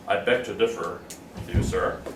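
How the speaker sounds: formal gentleman. Shown as a demonstration. Teacher hams up a stuffy gentleman objecting, low and pompous. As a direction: put on a stuffy formal gent. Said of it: "And say".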